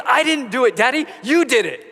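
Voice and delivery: put-on high, loud little boy's protest; toddler accusing. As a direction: blame Daddy like an indignant toddler. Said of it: "And he goes".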